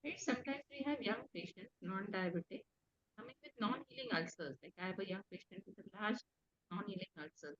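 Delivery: barely audible, faint and soft; speaking too softly to be heard; very low voice. Real, not acted. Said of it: "your mo-, voice is very low".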